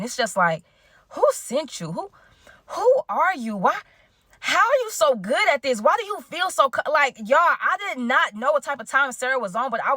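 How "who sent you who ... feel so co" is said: high-pitched, incredulous questioning; who-are-you amazement; mock disbelief. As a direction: play up the astonishment with big pitch swoops. Playful disbelief at Sarah.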